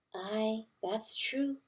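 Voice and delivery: soft, hushed mother hen; gentle hen. A narrator's reading. Hen calming grieving mice, soft and gentle. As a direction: soothe them quietly. Pertolote voiced softly.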